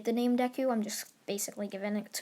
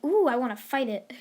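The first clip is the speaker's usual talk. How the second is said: louder, swooping eager "ooh"; hammed-up eagerness; eager, mock excitement; 2 semitones higher, 5 dB louder than usual. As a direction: play him as over-keen for a fight. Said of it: "he's not like that".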